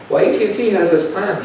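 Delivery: a high, pointed retort to the clerk; challenging the clerk, pitched high. Retold or imitated. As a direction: push back, voice up. Arguing with clerk.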